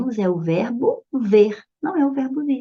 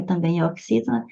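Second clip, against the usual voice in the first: usual speaking voice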